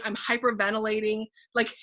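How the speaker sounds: ordinary delivery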